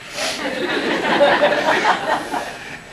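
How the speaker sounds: breathy laugh. Real, not acted. Awkward memory of principal's coffee.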